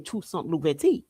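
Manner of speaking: ordinary delivery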